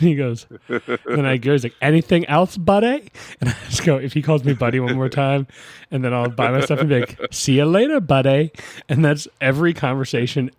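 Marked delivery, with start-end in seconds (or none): 1.8-3.1 higher mimicked shop-owner question, rising on "buddy"; put-on shopkeeper's catchphrase voice; same stock "buddy" delivery. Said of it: "he says it just like that". 7.3-8.5 higher mimicked farewell, lifting on "buddy"; put-on shopkeeper's catchphrase, pitched up at the start; same stock "buddy" delivery. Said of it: "there's the exact same".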